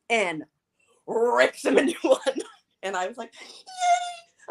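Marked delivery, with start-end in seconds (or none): 3.4-4.5 very high, thin little squeal of glee; gleeful cheer. Retold or imitated. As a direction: re-enact a delighted yay, squeaky. Acting out own delight.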